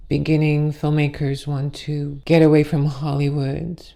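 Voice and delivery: ordinary delivery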